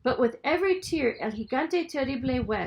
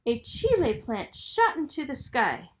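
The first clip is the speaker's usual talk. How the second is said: ordinary delivery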